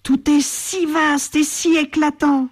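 slow, high-pitched wonder; marvelling. A narrator's reading. Bat lands among the flamingos, full of wonder. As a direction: stretch the words in amazement. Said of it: "s'émerveille Bat en atterrissant".

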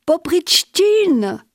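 nom crié lentement, plus aigu; appel. Retold or imitated. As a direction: appeler de loin en étirant. L'inquisiteur l'appelle. (l'inquisiteur appelle, fort et lent)

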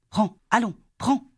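high-pitched little insistence; urgent coaxing. A narrator's reading. Sister pushing money on her brother, urgent coaxing. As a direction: press it on him, pitch lifted.

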